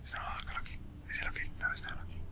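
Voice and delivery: sneaky whisper; hushed, stealing unseen. Real, not acted. Sneaking past the waiter.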